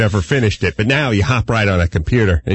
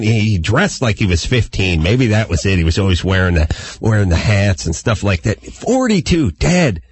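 usual speaking voice

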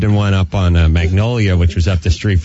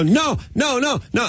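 high, rapid-fire refusals; mom's mock yelling; 14 semitones higher, 4 dB quieter than usual. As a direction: imitate a scolding mother refusing. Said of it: "and she'd yell".

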